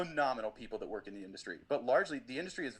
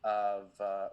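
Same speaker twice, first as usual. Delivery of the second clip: ordinary delivery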